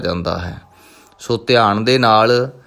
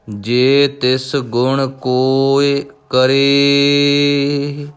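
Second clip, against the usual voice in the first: slow, drawn-out devotional chanting of each word; slow Gurbani recitation; much slower than usual. Acted. Teacher reciting Japji Sahib for students, slow devotional chant. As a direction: intone every syllable slowly and reverently.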